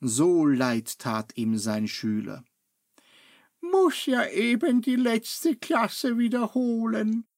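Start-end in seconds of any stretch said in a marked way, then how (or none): 3.6-7.3 put-on higher old master voice, lightly sad; old master murmuring into his beard; unhappy murmur. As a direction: mumble it, disappointed. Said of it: "murmelte er unglücklich".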